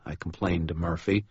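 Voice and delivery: plain reading voice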